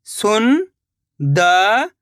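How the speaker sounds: सुंदर stretched very slowly, rising at the end; slow drawn-out reading. Shown as a demonstration. Teacher sounding out सुंदर for pupils, very slow and rising. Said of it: "शब्द को आपको खुद से पढ़ना है".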